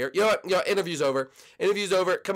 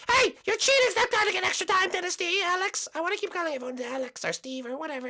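high falsetto outburst; shrieky falsetto, settling as it goes; indignant contestant. Acted. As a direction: shout the accusation in a squeaky girl voice.

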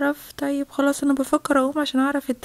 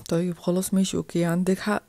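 deep, low adult voice; agreeing parent; 9 semitones lower than usual. Acted. Parent agrees with the other about the child, low. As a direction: concede in a much lower voice.

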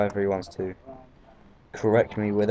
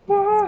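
loud, very high "wah"; high-pitched yelp; 6 dB louder than usual. Real, not acted. Player trying something in the game, high-pitched excited yelp. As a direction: let out a sudden high squeal. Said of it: "That was high pitch".

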